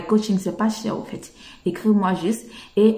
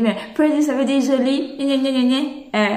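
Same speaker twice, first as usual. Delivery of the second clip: higher whiny mock objection; mocking viewer; 5 semitones higher than usual. Teacher mimics a student whining about pretty. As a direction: mimic a doubting student, nagging. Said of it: "vous allez me dire".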